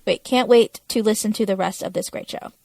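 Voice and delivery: ordinary delivery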